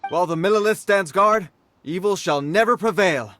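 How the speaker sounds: soldierly pride rings out; proud declaration. Acted. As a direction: proclaim it like a guard's creed. Guard proclaims his duty.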